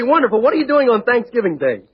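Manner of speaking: usual speaking voice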